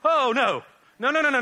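loud, high, hammed-up alarm; mock panic. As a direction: blurt it out like a horrified parent. Retells horror at child copying him.

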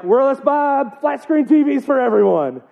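high, hammed-up enthusiasm; mock excitement. Mocking how we give everyone presents, exaggerated excitement. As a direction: announce it like a giddy big spender. Acted mock holiday spending spree.

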